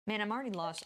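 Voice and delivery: normal talking voice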